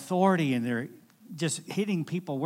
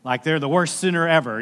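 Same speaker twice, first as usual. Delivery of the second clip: louder, hammed-up self-mocking sinner; mock sinner joke; 8 dB louder than usual. Someone jokes the church walls would burn, loud and hammed-up. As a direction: ham up the worst-sinner line. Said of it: "somebody said".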